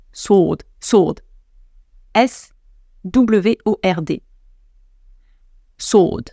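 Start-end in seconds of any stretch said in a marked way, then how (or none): 0.1-1.2 "sword" as "sawd", W left silent. Shown as a demonstration. Demonstrating a word she struggles with, "sword" without W. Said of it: "je le dis pas bien". 5.8-6.2 "sword" as "sawd", W left silent. Shown as a demonstration. Teaching the word for épée, "sword" without W. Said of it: "C'est l'épée".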